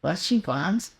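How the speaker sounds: a light Yiddish lilt on what you want; Yiddish accent. Shown as a demonstration. Host requests Yiddish accent, she obliges lightly. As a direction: ask it with a mild Yiddish accent. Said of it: "Yiddish accent".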